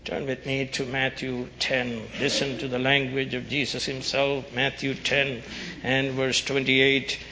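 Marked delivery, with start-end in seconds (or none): none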